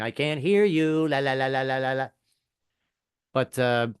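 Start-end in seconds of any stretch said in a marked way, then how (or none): 0.0-2.1 put-on childish blocking-out. Husband hiding in the bathroom from a fight, childish sing-song. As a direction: drone la-la-la to drown them out. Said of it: "cupping your ears and going".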